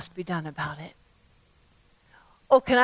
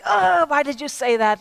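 higher groan at oneself; exasperated self-reproach; 6 semitones higher, 5 dB louder than usual. Retold or imitated. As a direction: kick yourself for saying it. Scolding self for stupid remark.

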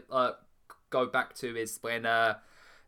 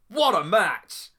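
excited; 8 semitones higher, 8 dB louder, slower than usual. Real, not acted. Gushing over a great match.

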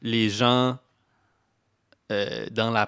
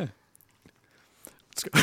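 breathy whispered aside; 5 dB quieter, much slower than usual. Real, not acted. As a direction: whisper it under a laugh. Whispered aside while laughing.

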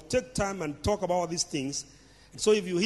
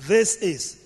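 "This" pushed out loud and falling; stress on THIS; 3 semitones higher, 6 dB louder than usual. Shown as a demonstration. Preacher stressing God's word to the congregation, loud emphatic "This". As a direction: lean hard on "This", not "that". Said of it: "not that is".

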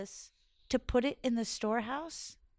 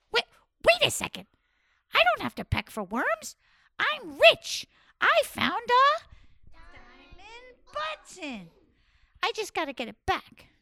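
high, louder gleeful squawk; excited rooster; 10 semitones higher, 8 dB louder than usual. Acted. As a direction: burst with excitement. Rooster excited realizing he's rich.

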